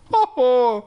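loud high laugh; a real laugh at his own slip; burst of laughter. Real, not acted. Reader laughing at his own misread line.

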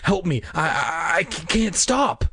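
high, stuttering panic; panicked cry for help. A narrator's reading. Boy being electrocuted calls to the girls, panicked. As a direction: stammer it out as if being shaken. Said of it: "his body vibrating and shaking harder and faster".

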